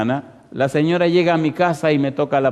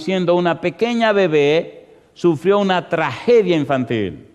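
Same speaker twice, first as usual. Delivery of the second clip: ordinary talk, nothing marked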